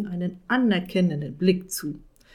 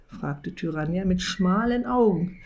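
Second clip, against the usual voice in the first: plain reading voice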